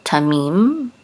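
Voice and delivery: tamim with stress on MIM; last-syllable stress. Shown as a demonstration. Teacher showing where the accent falls, stressing tamim's last syllable. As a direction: lean on the final syllable of "tamim". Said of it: "Accent is on the last syllable".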